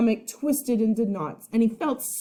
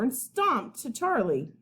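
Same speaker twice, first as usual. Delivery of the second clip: usual speaking voice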